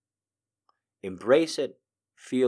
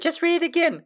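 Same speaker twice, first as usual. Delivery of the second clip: much higher girly voice imitating fans; mock girls' request. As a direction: squeal it like excited girls. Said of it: "A bunch of girls just said".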